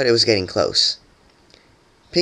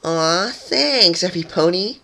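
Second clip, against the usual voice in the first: gleeful; 8 semitones higher, slower than usual. A narrator's reading. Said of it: "Pinkie smiled with glee".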